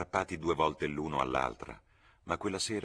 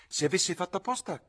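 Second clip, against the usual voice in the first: high, loud, insistent question; raised-voice repeat; 13 semitones higher, 7 dB louder than usual. A narrator's reading. George repeats his supposition after the interruption, voice raised. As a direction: say it over with a lifted voice.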